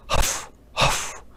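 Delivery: breathless whispered huffs; two heavy, winded puffs of air; heavy huffing. Acted. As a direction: puff hard, as if worn out from walking. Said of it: "she's breathing really hard".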